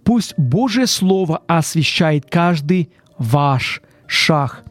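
ordinary talk

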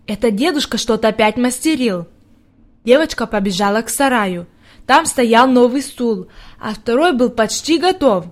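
usual speaking voice

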